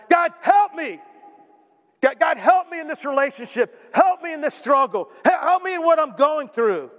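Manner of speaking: high-pitched pleading cry to God; desperate cry. Acted. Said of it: "cried out in desperation".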